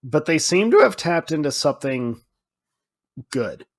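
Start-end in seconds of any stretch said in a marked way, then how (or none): none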